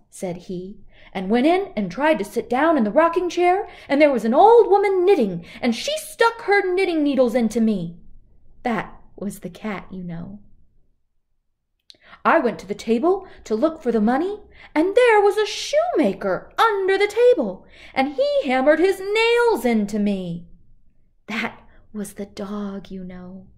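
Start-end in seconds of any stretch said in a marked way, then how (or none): 1.1-8.0 higher, louder, hurried frightened robber. Acted. Robber telling the gang about his scare, frightened. 12.3-20.5 higher, louder, hurried frightened robber. Acted. Robber telling the gang about his scare, frightened.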